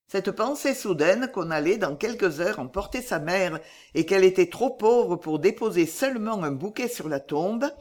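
plain reading voice

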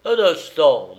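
stern, loud officer calling a halt; gendarme's commanding call; grave gendarme voice. A narrator's reading. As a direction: halt them with loud authority. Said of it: "dit le gendarme d'une voix grave".

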